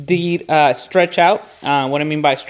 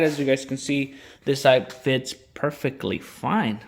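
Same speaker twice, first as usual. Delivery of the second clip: usual speaking voice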